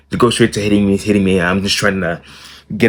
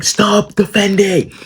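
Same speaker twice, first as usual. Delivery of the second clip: high, slow, stretched mock yell; mock the mom yelling as she grabs the pillow; angry command; 12 semitones higher, much slower than usual. Mom yelling at her son to stop blocking, a high stretched mock yell.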